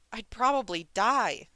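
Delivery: loud, slow, high despairing cry; terrified wail. A narrator's reading. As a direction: wail it out in dread. Terrified Windmaster.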